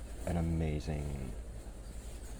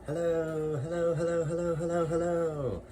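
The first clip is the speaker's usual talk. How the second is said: high, far-off singsong greeting, each hello floating up; high sing-song hellos; 10 semitones higher than usual. Acted. Greeting the camera in a high singsong hello.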